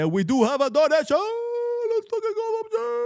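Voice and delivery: very high, loud squeal of joy; donation excitement. Real, not acted. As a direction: shriek happily at the new donation. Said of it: "Why do you say it like that?".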